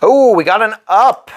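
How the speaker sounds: sudden high, loud oh of surprise; pleased startle at the card; surprised. Real, not acted. Player reacting to a lucky draw, surprised and loud.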